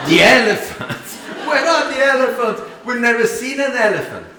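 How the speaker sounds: loud, high startled questions; mock alarm from the clueless Italians; alarmed disbelief. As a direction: panic at the thought of elephants. Retold alarm at elephants.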